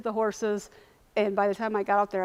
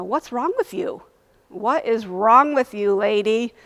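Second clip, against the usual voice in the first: louder, incredulous scolding; like you're nuts; 7 dB louder, slower than usual. Retold or imitated. Doctor to hysterical late patient, like she's nuts. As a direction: imitate judging someone as crazy. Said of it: "looked at me like I was nuts".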